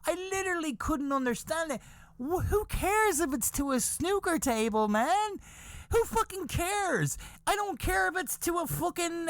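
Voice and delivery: high-pitched, incredulous rant; worked-up squeaky disbelief; exasperated. Real, not acted. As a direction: protest in baffled exasperation. Exasperated rant about the irrelevant detail.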